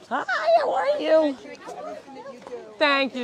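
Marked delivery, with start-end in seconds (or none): 0.1-1.4 high, sung-out hello; high friendly greeting to a visitor; bright greeting. Real, not acted. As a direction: greet a fan with a lifted voice.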